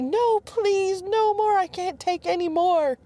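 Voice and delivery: high, loud, giggly pleading; crying for mercy. Acted. Wife pinned down and tickled, begs for mercy in a high voice. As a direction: beg him to stop tickling, half laughing. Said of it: "finally she cries for mercy".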